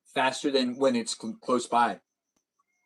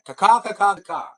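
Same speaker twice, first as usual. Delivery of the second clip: loud crow caws, pitched higher; mock crow cawing; 6 semitones higher, 7 dB louder than usual. Streamer goofing for chat, loud crow caws. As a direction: caw out like a crow.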